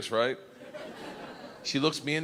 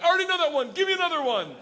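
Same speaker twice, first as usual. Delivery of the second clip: eager shout; 13 semitones higher, 4 dB louder, faster than usual. Retold or imitated. Eager student again.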